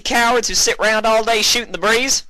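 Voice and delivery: put-on high, loud young mare shouting; high-pitched furious mare voice; Applejack's angry outburst. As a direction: spit out the scorn at the cowards.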